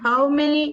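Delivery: HOW MANY said high and loud; emphatic correction. Real, not acted. Reader repeating a question for the teacher, emphatic on "How many".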